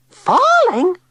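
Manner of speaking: very high, loud shriek. A narrator's reading. Tilly shrieking at Digby's news of snow. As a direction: shriek the word in disbelief. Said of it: "shrieked Tilly".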